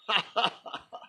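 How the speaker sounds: short high chuckle; light imitated chuckle. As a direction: imitate the monk's sudden little laugh. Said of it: "he suddenly starts laughing".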